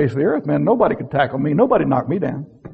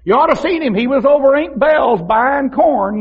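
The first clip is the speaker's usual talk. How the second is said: high, shouted small-town busybody; country gossip; 7 semitones higher than usual. Retold or imitated. Preacher voicing a country neighbour spreading gossip, high shouted gossip. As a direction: holler it like the town gossip who saw everything. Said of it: "they say".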